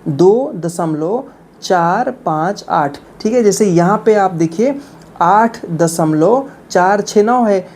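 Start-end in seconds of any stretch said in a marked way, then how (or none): 0.1-3.0 slow, each digit read separately. Shown as a demonstration. Teacher showing beginners how to read decimals, digit by digit. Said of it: "एक one by one पढ़ेंगे". 5.2-7.4 slow, separate "चार", "छः", "नौ"; digit by digit. Shown as a demonstration. Teacher showing the correct decimal reading, digit by digit. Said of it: "उनको एक one by one पढ़ेंगे".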